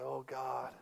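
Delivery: soft, low moaned prayer; a hushed early-morning plea; imitating grandma's quiet prayer; crying out. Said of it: "crying out to the Lord".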